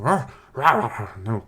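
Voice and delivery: loud, rising mock dog growl. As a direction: snarl out a dog's warning bark. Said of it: "And the dog's like".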